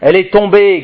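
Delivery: high, loud proclamation; angel's cry. A narrator's reading. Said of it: "crie un autre ange".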